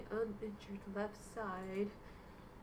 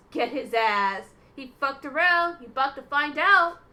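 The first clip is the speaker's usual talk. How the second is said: yelling much louder in hype; full-volume hype yell at the screen; hype shout; 3 semitones higher, 15 dB louder than usual. Real, not acted. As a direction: shout it like cheering a fight. Hyped reaction to game scene.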